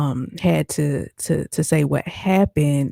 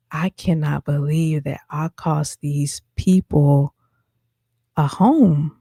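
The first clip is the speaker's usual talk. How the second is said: retold crying; slower than usual. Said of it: "I was crying".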